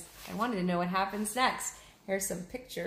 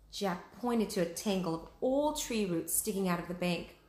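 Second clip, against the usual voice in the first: ordinary delivery, nothing marked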